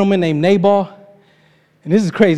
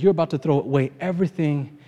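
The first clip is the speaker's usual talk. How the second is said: softer, low earnest plea to David; soft pleading; 6 semitones lower, 8 dB quieter than usual. Retold or imitated. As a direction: talk him down gently. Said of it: "she tells him".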